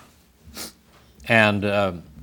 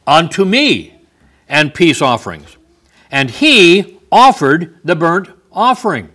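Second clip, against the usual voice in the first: scripture reading; 4 semitones higher, 7 dB louder than usual. Acted. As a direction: proclaim the verse to the congregation. Reads scripture at full force.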